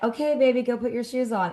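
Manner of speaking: mock-tender mom voice; sweet coaxing. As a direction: coax the little one gently. Sweet request to child.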